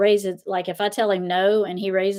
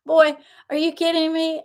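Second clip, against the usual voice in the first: disbelief; 8 semitones higher, 3 dB louder than usual. Retold or imitated. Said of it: "I'm like".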